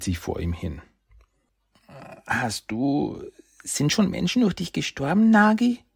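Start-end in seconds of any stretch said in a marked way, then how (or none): 2.3-5.8 put-on high child voice rising anxiously; a frightened little boy asking; young boy, fearful. Little brother asks Nagi if he has killed, high and anxious.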